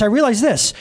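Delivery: ordinary talk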